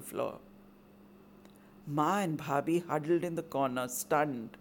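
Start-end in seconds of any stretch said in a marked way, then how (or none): none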